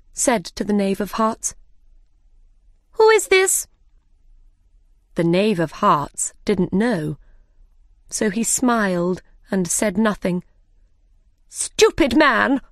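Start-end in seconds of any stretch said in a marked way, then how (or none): 2.9-3.7 imperious queen. Acted. The Queen demands. 11.5-12.6 a high, slow scornful shout; shouted insult. Acted. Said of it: "shouted the Queen".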